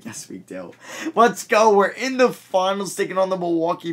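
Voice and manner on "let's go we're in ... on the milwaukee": loud, high victory shout; excited shout. Real, not acted. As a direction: yell it out in triumph. Celebrates reaching the finals.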